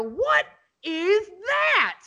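high, slow shocked demand; incredulous yell. Retold or imitated. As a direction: demand it in disbelief. Confronting client about the new car.

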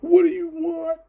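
exasperated yell. Retold or imitated. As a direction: blow up at mom over dinner plans. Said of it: "I'm like".

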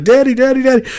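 imitating a scared child, very high squeal; mock a small boy's loud, high cries for help; panicked little kid. Four-year-old son calling to dad as the wave comes in, panicked.

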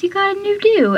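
high, teasing surprise; high surprised quote. Retold or imitated. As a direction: squeak it out, amazed. Quoting Pacey's surprise.

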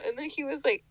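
ordinary talk, nothing marked

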